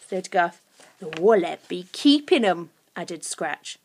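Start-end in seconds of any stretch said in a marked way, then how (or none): 1.0-2.7 lightly voiced low creature; a touch of goblin menace; menacing creature. A narrator's reading. Third creature adds to the threat, menacingly.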